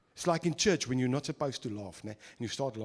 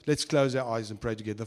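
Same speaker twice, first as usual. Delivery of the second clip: usual speaking voice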